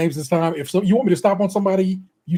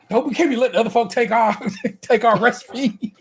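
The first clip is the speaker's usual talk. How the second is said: laughing; 6 semitones higher than usual. Real, not acted. Laughing while joking about recipes.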